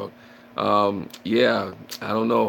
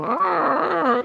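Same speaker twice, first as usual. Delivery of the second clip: startled scream; 6 dB louder than usual. Retold or imitated. Jokes about fearing animals.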